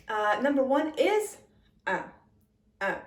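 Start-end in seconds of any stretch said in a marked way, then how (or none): 1.9-3.0 un through the nose, nasal vowel; nasal French un. Shown as a demonstration. Teacher modeling un through the nasal passages. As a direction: push un up into the nose. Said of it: "say it through the nasal passages".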